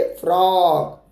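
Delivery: slow drawn-out frock; teacher modelling frock slowly; model word. Shown as a demonstration. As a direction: stretch frock so kids can repeat. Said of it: "बोलिए F R O सी के".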